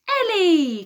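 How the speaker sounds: high loud cry of the name; joyful cry. A narrator's reading. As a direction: call his name in joy. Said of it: "cried Aphrodite".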